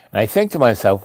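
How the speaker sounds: usual speaking voice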